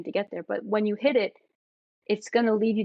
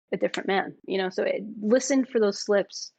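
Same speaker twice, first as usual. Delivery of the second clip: normal talking voice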